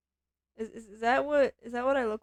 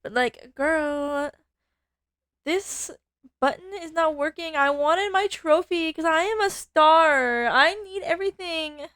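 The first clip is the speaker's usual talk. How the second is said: put-on Valley Girl voice; 3 semitones higher, 8 dB louder than usual. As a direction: play the spoiled Valley Girl star, louder. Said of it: "I haven't done a Valley Girl voice".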